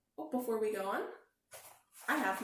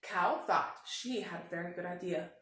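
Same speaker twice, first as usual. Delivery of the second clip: ordinary delivery, nothing marked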